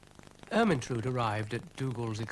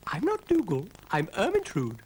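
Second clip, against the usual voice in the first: proud; 9 semitones higher, 6 dB louder than usual. Acted. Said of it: "rather proudly".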